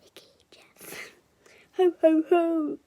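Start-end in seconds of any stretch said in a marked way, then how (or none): 1.8-2.8 loud ho ho ho; booming laugh. A narrator's reading. Jeff being tickled, booming laugh. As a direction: boom out the laugh. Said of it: "boomed Jeff".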